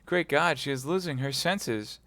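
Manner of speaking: rising panic over her; mild alarm; alarmed young man. Acted. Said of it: "cried D'Artagnan".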